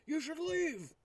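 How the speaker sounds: urging character. Acted. Player tells companion to go, high urging character voice. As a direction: urge them away, pitched high.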